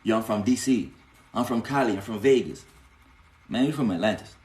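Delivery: normal talking voice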